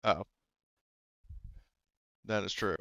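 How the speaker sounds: usual speaking voice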